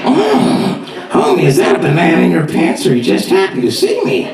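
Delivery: cartoon wife flirting with her husband; Marge Simpson. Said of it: "an adult version of The Simpsons".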